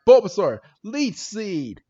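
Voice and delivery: high call, loud at first then falling away; mock battle command. Podcaster retells Ash ordering Bulbasaur, commanding. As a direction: call out an attack like a cartoon trainer.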